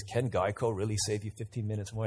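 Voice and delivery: lightly voiced commercial announcer; radio announcer voice. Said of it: "that radio voice comes on and he says".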